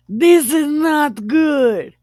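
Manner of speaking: high, loud, drawn-out verdict; hammed-up scolding; emphatic mock disapproval. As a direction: stretch it out in comic alarm. Father-in-law's verdict, hammed up.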